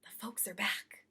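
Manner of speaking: hushed whisper. Acted. Amelia Bedelia whispering that the folks are back, hushed. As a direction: breathe it out urgently under your breath. Hurried warning before folks enter.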